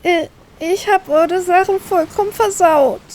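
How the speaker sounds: high, slow, tearful sniffle; sniffling. Acted. Girl sniffles to her brother about ruined things. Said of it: "schniefe ich".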